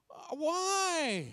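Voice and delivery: high, drawn-out bewildered why; retold incredulous question; baffled disbelief. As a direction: ask it utterly dumbfounded. Retold baffled reply to wife.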